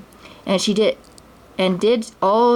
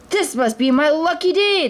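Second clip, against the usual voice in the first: much higher gloating voice; gleeful; 8 semitones higher than usual. A narrator's reading. The thief climbs on the camel, gloating. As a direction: gloat over the prize. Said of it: "the thief said".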